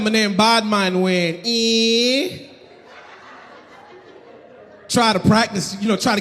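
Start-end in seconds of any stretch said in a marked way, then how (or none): 0.0-2.5 slow, drawn-out patois name; mock Jamaican bad man. Comic replays his persona in a slow mock patois. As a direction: lay on the Jamaican patois, slowly. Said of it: "So I said".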